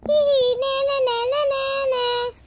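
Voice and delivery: high, loud na-na singsong; mocking nyah-nyah; teasing taunt. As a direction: gloat like a bratty kid. Doll taunting the other.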